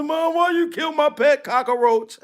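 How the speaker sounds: high, whiny mock protest; hammed-up childhood wail; mock crying child. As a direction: imitate a tearful kid complaining to mom. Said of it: "I was crying one time".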